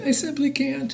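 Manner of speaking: high, soft little voice; baby dinosaur. A narrator's reading. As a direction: apologise as a timid baby dinosaur. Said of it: "And he said".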